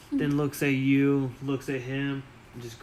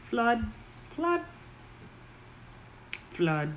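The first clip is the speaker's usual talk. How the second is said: very high, fast goblin pleading; fast high goblin begging; 10 semitones higher, 5 dB quieter, faster than usual. Acted. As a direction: rattle it off high like a hungry goblin. Goblin Momo begs food.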